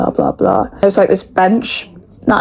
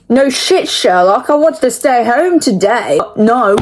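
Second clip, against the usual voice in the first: sarcastic retort; 4 semitones higher, slower than usual. Retold or imitated.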